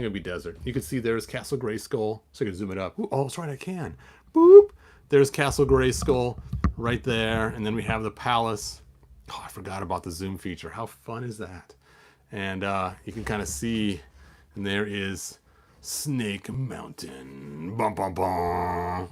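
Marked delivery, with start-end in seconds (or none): 4.3-4.7 high, loud boop; zoom sound effect. Pretend. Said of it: "zoom sound effect". 15.8-19.1 low, slow, quieter sinister reveal; ominous announcement. Pretend. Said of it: "ominous villain lair".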